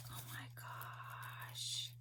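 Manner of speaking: slow, breathy whisper of wonder; awed whisper. Real, not acted. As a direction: barely breathe the words, moved. Whispering over gift.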